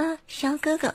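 very high squeaky loli voice. Shown as a demonstration. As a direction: push it way up like a loli. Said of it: "不用这种".

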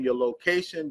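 ordinary talk, nothing marked

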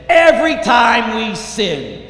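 high, loud and slow; impassioned preaching. Real, not acted. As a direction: hammer the accusation home. Preacher at full force.

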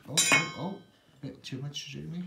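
usual speaking voice

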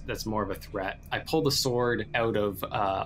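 usual speaking voice

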